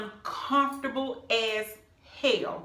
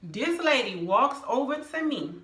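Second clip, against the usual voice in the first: ordinary talk, nothing marked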